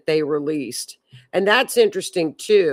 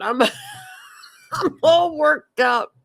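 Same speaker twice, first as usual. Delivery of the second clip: laughing mock whine; 9 semitones higher than usual. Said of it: "And she's like".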